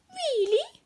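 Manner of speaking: doubtful little mouse; surprised squeak. A narrator's reading. Mouse queen doubting the scarecrow, high squeak. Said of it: "asked the mouse".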